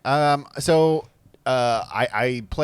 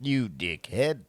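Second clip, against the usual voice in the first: slow, softer, oddly stilted insult; unnatural and weird; 5 dB quieter, slower than usual. Retold or imitated. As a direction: imitate a weirdly unnatural line read, drawn out. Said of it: "sounds really unnatural and weird".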